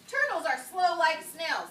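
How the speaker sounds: loud, drawn-out exclamation; exclaimed. Shown as a demonstration. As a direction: read it out emphatically, with an exclamation point. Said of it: "sounds like it deserves an exclamation point".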